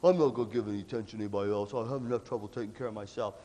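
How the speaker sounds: a hint of a low, dismissive grumbler; grumbling singer. Imitating a self-centered group member, with a low grumble.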